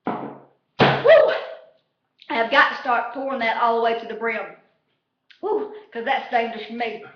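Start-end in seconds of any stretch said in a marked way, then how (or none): 0.0-1.3 high, loud whoop after a shot. Real, not acted. Toasting the ousted player, a loud high whoop. 5.4-5.7 a higher second whoop. Real, not acted. After the toast's burn, a smaller high whoop.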